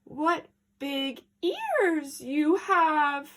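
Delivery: drawn out, very slow and wary; cautious slow question; slowly. Acted. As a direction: spell it out uneasily. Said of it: "she said slowly".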